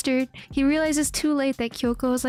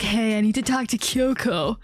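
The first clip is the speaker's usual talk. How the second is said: mock dramatic Sho; 3 semitones lower than usual. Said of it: "saunters up dramatically".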